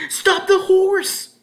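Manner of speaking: high, loud, slow desperate scream; panicked scream. A narrator's reading. Ellen begging Murdoch to stop the horse, panicked scream. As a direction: scream at him to halt. Panicked cry after shriek.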